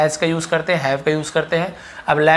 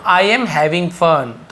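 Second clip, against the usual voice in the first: slow model sentence; much slower than usual. Shown as a demonstration. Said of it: "तो fun करने को हम having fun बोलते हैं".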